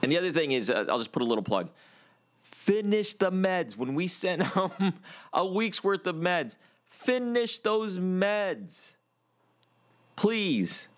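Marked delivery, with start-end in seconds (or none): 2.4-10.9 high, slow pleading. Real, not acted. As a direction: beg them to finish the meds. Vet pleads with pet owners.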